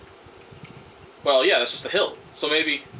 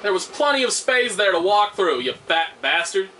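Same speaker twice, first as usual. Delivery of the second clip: usual speaking voice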